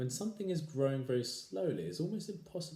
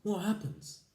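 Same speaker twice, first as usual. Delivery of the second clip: frightened wife; 9 semitones higher, 4 dB louder, slower than usual. Acted.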